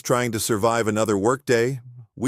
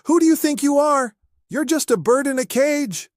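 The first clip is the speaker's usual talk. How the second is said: very high, loud shout; shouting at the parrot; 4 dB louder than usual. Acted. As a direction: bellow the bird into silence. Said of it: "and shouts".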